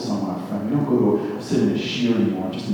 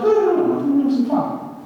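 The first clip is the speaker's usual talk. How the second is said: mock chirpy woman; 3 dB louder than usual. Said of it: "She's like".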